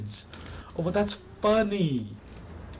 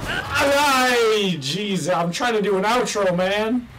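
loud, raised-pitch scolding; exasperated yelling; 4 semitones higher, 5 dB louder, slower than usual. Real, not acted. Gamer interrupted while doing an outro, exasperated yell. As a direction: snap loudly at the interruption.

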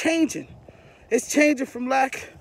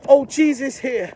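ordinary talk

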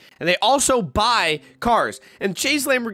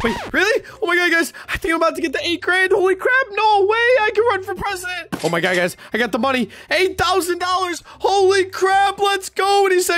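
rising, higher excited disbelief; joy breaking through, getting higher; dawning joy; 9 semitones higher than usual. Real, not acted. Sells car, gets the money.

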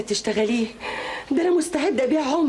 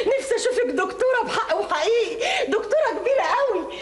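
higher, words through happy laughter; laughing joy; 7 semitones higher than usual. Acted. Mother tells daughter her wish, laughing and high.

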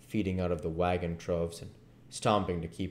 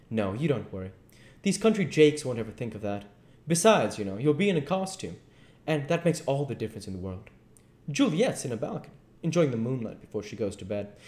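smooth-talking duke; 6 semitones higher, faster than usual. Acted. Duke voiced in the story.

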